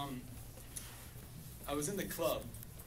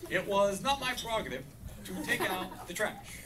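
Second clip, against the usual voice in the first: lightly voiced, slightly higher Obama; a hint of presidential Obama, measured and a bit higher; Barack Obama impression; 6 semitones higher, 5 dB louder than usual. Obama answers his wife about the trash, lightly imitated. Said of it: "impression of, uh, Barack Obama".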